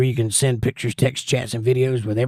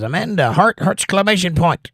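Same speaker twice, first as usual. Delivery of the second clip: raised, louder read-out of an emphatic message; 7 semitones higher, 5 dB louder than usual. Retold or imitated. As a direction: punch out "exclamation point" high and loud. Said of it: "That's an emphatic heart".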